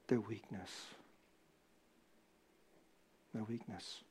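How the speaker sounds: very soft, low, drawn back; hushed repetition. Real, not acted. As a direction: drop to almost nothing, heavy. Solemn repeated climax.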